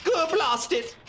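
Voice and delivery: put-on high, exasperated German accent; German-accented captain. Captain cursing his stuck soldier, exasperated German accent. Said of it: "that was a German accent".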